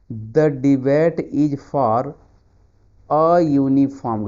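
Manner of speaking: very slow, drawn-out answer reading. Shown as a demonstration. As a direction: spell out the right answer slowly. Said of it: "reads answer slowly". (धीरे-धीरे उत्तर पढ़ना)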